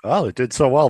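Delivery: ordinary delivery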